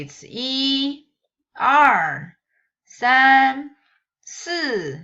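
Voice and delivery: each number yi er san si wu liu qi ba jiu said loud in its own tone; teacher drilling Mandarin numbers with exaggerated tones; counting in Mandarin tones. Shown as a demonstration. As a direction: count one to nine in Mandarin, every tone exaggerated. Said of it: "when you learn it individually".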